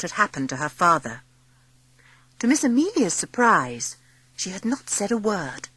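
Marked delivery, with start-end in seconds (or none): none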